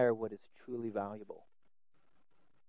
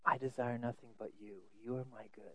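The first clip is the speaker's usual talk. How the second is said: quiet, tender confession; hushed devotional quote; soft prayer; 2 semitones lower, 12 dB quieter, faster than usual. Acted. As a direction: say it softly to God. Quoting the psalmist's prayer.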